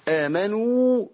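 careful tajweed recitation; recited madd. Shown as a demonstration. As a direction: hold the long و of آمنوا clearly. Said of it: "أدي واو مادية".

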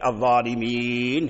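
long ii into a still final nun; الظالمين in pausal form. Shown as a demonstration. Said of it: "هنا جمعت الياء ساكنة والنون ساكن". (الوقف على الظالمين بمد الياء وسكون النون)

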